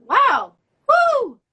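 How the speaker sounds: loud, high whoop of delight; excited cheer. Real, not acted. Moved by a fan's message.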